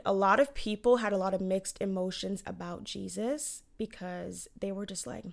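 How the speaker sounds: ordinary talk, nothing marked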